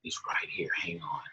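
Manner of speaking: whispered, hushed aside; secretary whispering into the phone. Retold or imitated. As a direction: whisper it so the boss won't hear. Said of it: "She goes".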